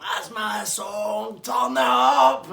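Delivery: slow, loud stretched shout; drawn-out party yell. Retold or imitated. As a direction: drag out each word over the music. Gary yelling over music.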